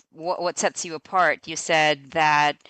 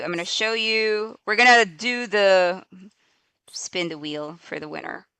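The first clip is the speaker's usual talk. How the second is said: normal talking voice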